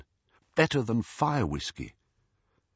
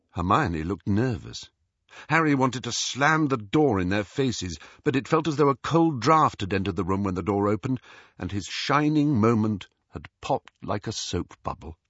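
plain reading voice

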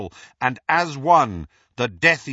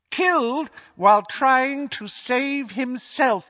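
high, slow, cold dark-lord voice; high menacing villain, drawn out; Voldemort gloating; 14 semitones higher, 4 dB louder than usual. Acted. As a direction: gloat over the fallen hero to the crowd. Voldemort repeats the lie.

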